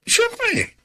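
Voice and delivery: high playful squeak; teasing squeal. Acted. Wilson squealing playfully to Abby. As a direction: squeal it like a tease.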